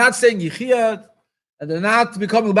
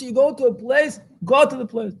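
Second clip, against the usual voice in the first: usual speaking voice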